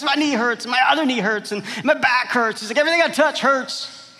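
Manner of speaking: high, loud pained whine; pained patient. Acted. Patient tells doctor every spot hurts, high pained whine. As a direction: cry out at every touch.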